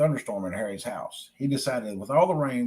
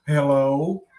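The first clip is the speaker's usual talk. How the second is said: shaky voice; 5 dB louder than usual. Acted. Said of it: "said in a shaky voice".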